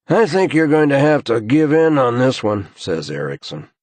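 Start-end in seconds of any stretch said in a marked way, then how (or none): 0.1-2.7 higher, louder, worn-out colleague; weary, wry man on his way home; tired investigator voice. A narrator's reading. Narrator voicing Eriksson.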